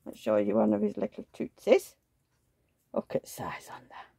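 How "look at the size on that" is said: soft whispered admiration; whispering. Real, not acted. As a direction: whisper it in awe. Admiring the doll's tiny foot.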